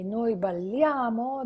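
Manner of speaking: "Noi balliamo" with stress on the a of -liamo, slow. Shown as a demonstration. Teacher showing students the stressed A in balliamo. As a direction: lean on "balliamo" at lia, slowly. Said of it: "the emphasis is in A".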